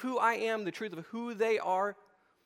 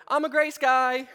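louder, higher mock plea of a guilty man; mock pleading; 5 semitones higher, 7 dB louder than usual. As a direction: blurt it out like you just got caught. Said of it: "I go".

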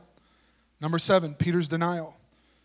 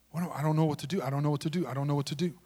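a hint of lost Peter, softer and lower; doubting whisper-like murmur; 4 semitones lower, 7 dB quieter, much faster than usual. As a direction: murmur it to yourself, helplessly unsure. Peter voiced in his doubt.

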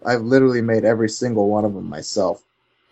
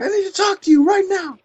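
urgent big guy. Retold or imitated. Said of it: "and he's like".

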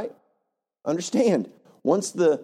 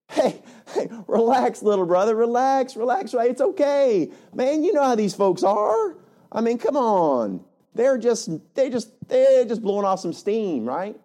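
voicing Aaron; 5 semitones higher than usual. Preacher voices Aaron soothing Moses.

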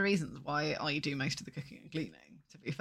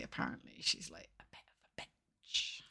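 hushed gossip whisper; 12 dB quieter than usual. Real, not acted. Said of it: "even whispering it's still slander".

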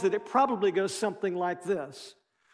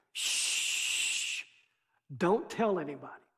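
soft shush, then a hushed quiet warning; 4 semitones lower, 6 dB quieter, slower than usual. Retold or imitated. Jesus hushing the healed leper, quiet shush. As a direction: hush him like a secret.

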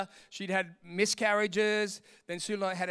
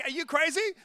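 higher-pitched mock disbelief; incredulous protest; 7 semitones higher than usual. Sinner answering God's you're delivered, acted incredulous protest. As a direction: question it in acted astonishment.

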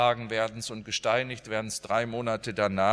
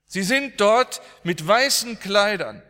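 much higher and louder, pressing eager calls; urging mother to look at the ceiling; urgent wonder; 9 semitones higher, 7 dB louder than usual. Retold or imitated. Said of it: "zeigte an die Zimmerdecke und er sagte". (drängend und staunend, deutlich höher und lauter)

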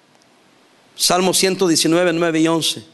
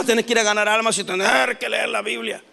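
mock reluctance; 3 dB quieter than usual. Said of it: "A algunos se les hace un problema".

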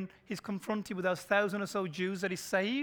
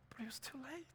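hushed whisper; 6 semitones higher, 16 dB quieter than usual. Acted. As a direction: whisper it gravely. Solemn aside after the scene.

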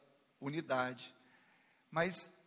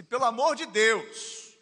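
high, loud, a touch of begging plea; Paul pleading loudly with the church; pleading apostle; 8 semitones higher, 10 dB louder than usual. Retold or imitated. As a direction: beg the brothers urgently. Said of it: "Tipo assim".

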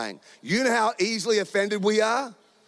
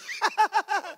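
high, put-on laugh; exaggerated high staccato laughing; mock laugh; 13 semitones higher than usual. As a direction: fake a high-pitched laugh in response. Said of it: "I've usually responded with".

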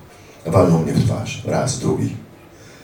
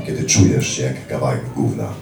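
normal talking voice

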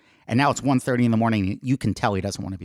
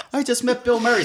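much higher, laughing with disbelief; laughing it out to his friend on the street; giddy laugh; 12 semitones higher than usual. Retold or imitated. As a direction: let the laugh burst through the words. Giddy after meeting idol.

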